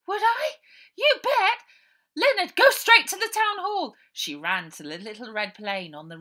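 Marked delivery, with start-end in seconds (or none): 0.1-3.9 much higher, louder, eager; thrilled. Acted. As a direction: burst out with joy. Ida thrilled to fly.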